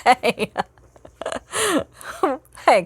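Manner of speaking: ordinary talk, nothing marked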